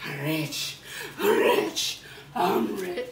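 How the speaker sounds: low, gloating chuckle; low chuckling. Acted. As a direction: savour it under the breath. Low take after the shrieks.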